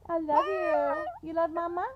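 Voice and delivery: high, loud pet talk. Real, not acted. Mother telling her dog she loves him, high doting baby talk. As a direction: gush over the dog up high.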